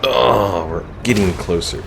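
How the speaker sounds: ordinary delivery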